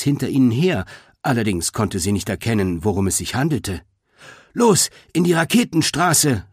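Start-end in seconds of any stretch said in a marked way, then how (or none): 4.5-6.4 high-pitched, urgent command; calling out. A narrator's reading. Boar calling to the others on the run, urgently. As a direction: call the order to the whole group. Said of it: "rief Greta den anderen zu".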